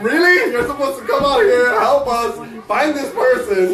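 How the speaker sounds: high, loud indignant disbelief; indignant retort. Retold or imitated. Friends scolding the police, mock indignant and high.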